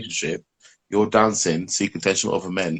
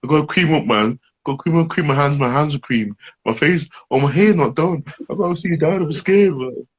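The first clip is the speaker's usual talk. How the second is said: mock nervous boyfriend; 7 semitones higher, 3 dB louder than usual. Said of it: "It was like".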